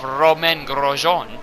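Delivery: a self-mocking French pronunciation of "Grosjean"; French accent. Commentator names a rival driver, playful French accent. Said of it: "fun to say his name like that, even though my French accent sucks".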